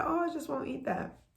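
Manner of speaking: mock resolve. To herself about skipping food, mock resolve. Mimicking her usual self-talk.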